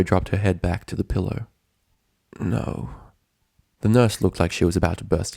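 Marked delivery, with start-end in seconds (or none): 2.4-2.9 flat quiet no. A narrator's reading. Dash refusing, head on pillow.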